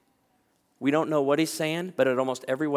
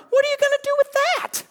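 much higher, louder suspicious squeal; suspicious woman; 7 dB louder than usual. Retold or imitated. Anxious woman questioning the photographer, high squeaky suspicion. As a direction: interrogate the camera holder in a squeaky voice.

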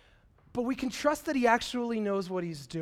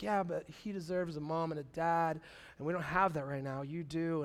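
quiet; 5 semitones lower, 7 dB quieter than usual. Retold or imitated. Birth mom's quiet answer.

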